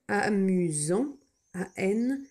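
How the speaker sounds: Amusant read slowly; slow dictation. Shown as a demonstration. Teacher dictating Amusant slowly. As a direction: draw out Amusant. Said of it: "dictation read slowly".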